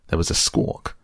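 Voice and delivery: ordinary delivery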